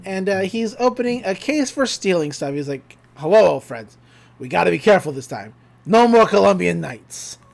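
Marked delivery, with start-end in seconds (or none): 3.2-7.4 low, put-on schemer's voice; Stan Pines. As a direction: greet your tools like an old crook, lightly hammed-up. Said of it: "So we cut over to Stan".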